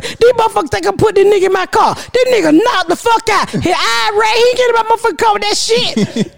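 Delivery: high, worked-up rant; retold indignant protest. Mother retells refusing dispatcher's instructions, indignant. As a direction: rattle it off high, acting outraged.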